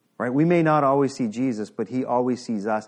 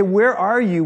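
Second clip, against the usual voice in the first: raised, pleading call of a lost believer; crying out to God; 5 semitones higher, 6 dB louder, slower than usual. Retold or imitated. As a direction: call out louder to an absent God. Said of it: "cried out to him".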